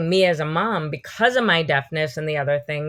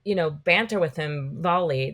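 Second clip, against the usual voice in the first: ordinary delivery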